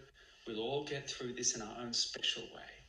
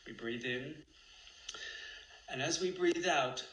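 guided meditation. Acted. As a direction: guide each breath calmly.

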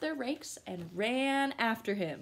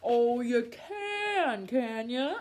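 slow, louder teasing; sly wolf; 5 dB louder, much slower than usual. Acted. Wolf doubting the gingerbread man, slow tease. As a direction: stretch it out slyly.

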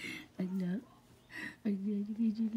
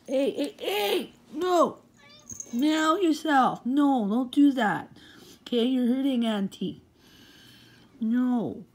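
pained scolding; 9 dB louder than usual. Real, not acted. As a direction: yelp and scold the biting pet. Pet attacking her.

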